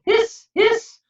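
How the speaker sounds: high whistling hiss; kettle hiss. A narrator's reading. Kettle sound in story.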